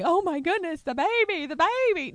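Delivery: high, loud mock panic; hammed-up anxious parent in a flap; freaking-out parent. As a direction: squeal it like an overprotective parent. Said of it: "always freaking out about everything".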